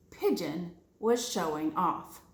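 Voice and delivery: ordinary delivery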